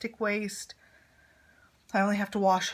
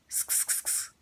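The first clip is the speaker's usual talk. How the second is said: soft breathy unvoiced sk clicks, imitating a teen; mock VSCO sk sound; 8 dB quieter than usual. Son teasing mom over her Hydro Flask, unvoiced sk clicks. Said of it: "he would be sitting there going".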